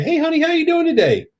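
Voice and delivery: high-pitched, put-on Southern hello; friendly Southern greeting. Southerner greeting someone at Walmart, high-pitched and friendly. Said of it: "This is how we talk all the time".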